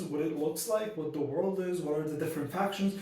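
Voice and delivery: normal talking voice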